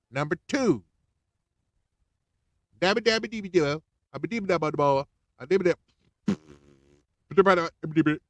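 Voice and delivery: high, loud mock babbling; mocking Biden gibberish. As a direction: babble nonsense like a confused old man. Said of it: "He gonna bust out and say".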